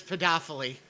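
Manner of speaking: pedophily as "puh-DOFF-uh-lee"; mocked mispronunciation. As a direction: present pedophily like a name, stressed on DOFF. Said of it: "sounds like the name of".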